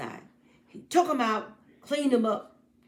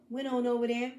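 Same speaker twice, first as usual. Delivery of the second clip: plain reading voice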